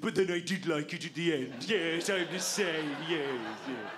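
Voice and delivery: hammed-up grunting male small talk; two blokes grooming like orangutans. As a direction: drone like two apes picking fleas. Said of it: "pair of orangutans on a log".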